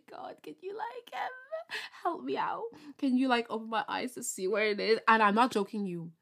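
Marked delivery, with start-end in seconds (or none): 0.1-5.0 a soft, breathy half-whisper, near tears; pleading prayer. Pretend. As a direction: beg God quietly, mock desperate. Pleading prayer for lost piercing.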